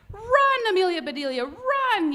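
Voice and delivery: a high, loud yell of run. Acted. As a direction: cheer her on with a yell. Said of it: "yelled the boys".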